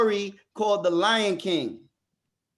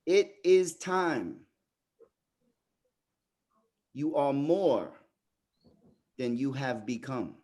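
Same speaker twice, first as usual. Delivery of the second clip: father's voice from the reflection; 4 semitones lower, slower than usual. Retold or imitated. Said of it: "he hears these words".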